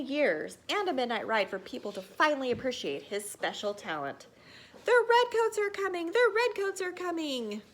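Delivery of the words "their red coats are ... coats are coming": high, loud ringing warning cry; alarm shout. Acted. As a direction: bellow the warning through town. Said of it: "like a bullhorn in a china shop".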